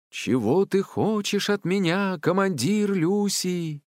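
high, drawn-out question; grand forest voice. A narrator's reading. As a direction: slowly intone it like a powerful spirit. Forest spirit questions the commander. (величавый протяжный вопрос)

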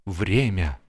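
ordinary delivery, nothing marked

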